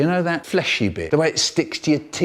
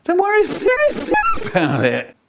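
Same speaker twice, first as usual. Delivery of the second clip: squeaky, much higher laughing "don't worry"; laughing reassurance. Retold or imitated. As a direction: break into a high giggle mid-reassurance. Son reassuring mum, squeaky laugh.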